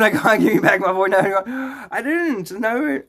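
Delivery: high, whiny protest; mock panic. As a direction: protest like someone cornered, hammed-up. Playing cornered, phone taken.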